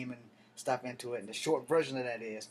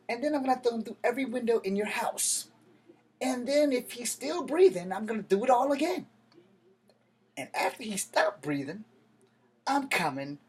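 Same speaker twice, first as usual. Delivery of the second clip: rising higher and louder until a scream; building to a scream; 10 semitones higher, 7 dB louder than usual. Retold or imitated. Threatening the old man over his nephew, building to a scream.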